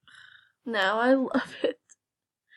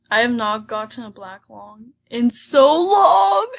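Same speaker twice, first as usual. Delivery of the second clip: excited; 10 dB louder than usual. Real, not acted. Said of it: "I am also excited".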